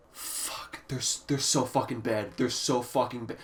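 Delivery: hushed, deflated swearing; quiet despair. Real, not acted. Reviewer despairing over the album's vocals, quiet despair.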